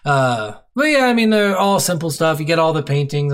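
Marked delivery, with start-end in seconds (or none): none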